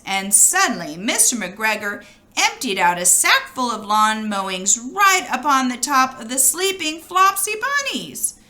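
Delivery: ordinary delivery, nothing marked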